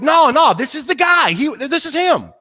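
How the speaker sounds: high, loud, eager protest; excited insistence. Retold or imitated. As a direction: insist it's really him. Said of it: "But other people are like".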